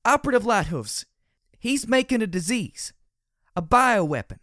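loud, high, urgent shouting. Acted. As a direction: yell the warning, high and loud. Glory shouting in the quarrel.

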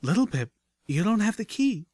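very high companion voice, puzzled concern; frowning objection. A narrator's reading. Medic companion objects to friend's risky plan, concerned. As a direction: point out the flaw, pitch climbing high. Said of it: "Velvet Remedy frowned".

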